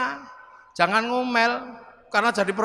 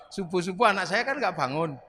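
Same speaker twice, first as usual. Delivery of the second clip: ordinary talk, nothing marked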